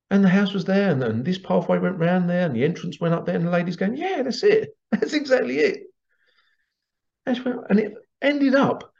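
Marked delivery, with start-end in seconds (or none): 0.1-3.4 high, eager "hold on"; excited interruption. Retold or imitated. Workshop lady cutting in when she recognizes the same house, high and eager. Lady describes the shared memory. 4.0-5.8 high, thrilled "that's it" with a laugh; excited confirmation. Retold or imitated. Lady confirming the matching past-life memory, high and delighted.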